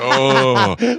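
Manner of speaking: high loud disgusted groan; retold gag. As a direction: recoil as if about to throw up. Disgusted reaction to somersault.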